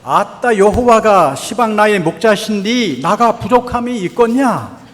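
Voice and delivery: loud, playful Jeolla dialect reading. Shown as a demonstration. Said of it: "예, 전라도 버전입니다". (큰 소리로 웃으며 읽는 전라도 사투리)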